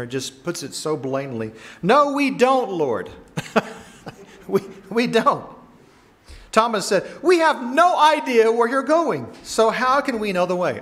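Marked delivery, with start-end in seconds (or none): 1.8-5.5 sharp high blunt "No, we don't"; blunt flat denial. Retold or imitated. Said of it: "I think he said it just like that". 7.2-10.8 exasperated protest. Retold or imitated. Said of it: "I think he said it just like that".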